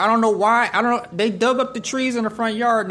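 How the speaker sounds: loud, high-pitched bafflement; worked-up storytelling, voice lifted; exasperated. Real, not acted. Exasperated telling of boys' mischief.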